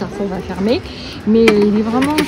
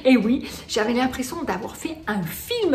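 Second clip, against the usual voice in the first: ordinary talk, nothing marked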